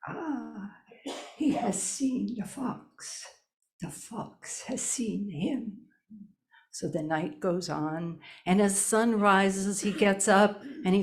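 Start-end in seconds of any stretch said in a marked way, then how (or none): 0.1-5.9 put-on old healer. A narrator's reading. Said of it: "old Duck Egg back at the house says".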